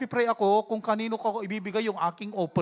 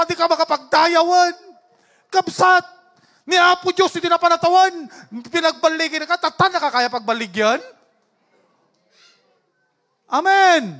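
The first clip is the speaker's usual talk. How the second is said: raised, insistent preacher voice; preaching at full force; 5 semitones higher, 7 dB louder than usual. Real, not acted. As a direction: drive the point home to the congregation. (malakas at mariing pangangaral)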